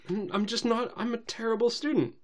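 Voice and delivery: high, stammering mock-distraught confession; Twilight. Student confessing failure to the princess, high mock distress. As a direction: raise the pitch like a fretting pupil.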